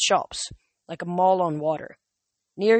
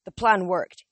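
ordinary delivery, nothing marked

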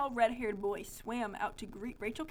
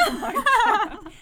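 high, loud, slow wail of laughter; helpless laughing; 9 dB louder, slower than usual. Real, not acted. Reader unable to read the story, laughing helplessly. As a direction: lose it completely.

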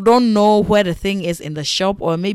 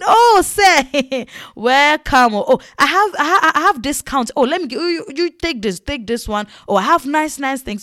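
high, loud overeager shopkeeper welcome; pretend fawning; 5 semitones higher, 3 dB louder than usual. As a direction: gush with fake flattery to win a big customer. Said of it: "Come and see pretending".

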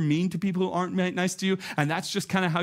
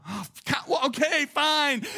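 higher, huffy giving in; grudging surrender; 10 semitones higher, slower than usual. Retold or imitated. Pastor giving in to the Spirit, huffy and grudging. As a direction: sigh it out like a sulky teen.

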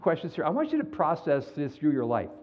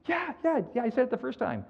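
high, impatient yeah yeah; exasperated Peter; 9 semitones higher than usual. Retold or imitated. Peter answering Jesus, high and exasperated. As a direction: brush off the repeated question.

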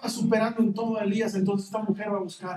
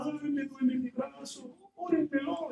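mock whine, higher, softer and slow; mock whining; 6 semitones higher, 6 dB quieter, slower than usual. Preacher mocking a member who begs prayer for his arm, whiny. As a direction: parody a self-pitying churchgoer. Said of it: "en lugar de estar llorando".

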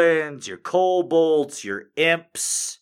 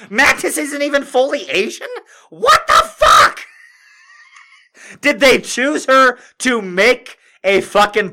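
outraged; 10 semitones higher, 7 dB louder than usual. Real, not acted. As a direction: let the outrage boil over. Outraged over Mantis casting.

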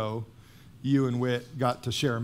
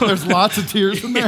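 much higher and louder, laughing; 8 semitones higher, 9 dB louder than usual. Real, not acted. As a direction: laugh the question out. Host laughs back at joke.

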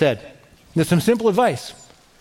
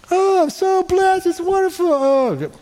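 hammed-up high-pitched rapture; mock bliss; 12 semitones higher than usual. Mimics believer during life's ups.

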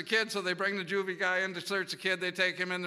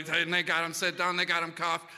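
ordinary talk

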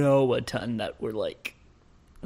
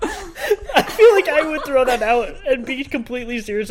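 high, loud, laughing; 11 semitones higher, 6 dB louder than usual. Real, not acted. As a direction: giggle through the words. Laughing while talking.

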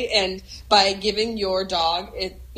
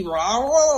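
higher, slurred mock dog bark; garbled bark imitation; 5 semitones higher, 3 dB louder than usual. Imitating the dog's dramatic bark for a friend, garbled. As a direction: bark as if through a mouthful of marbles. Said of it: "like marbles in your mouth sort of sound".

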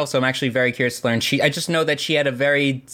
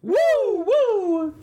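Ric Flair woo. Retold or imitated. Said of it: "because he's Ric Flair".

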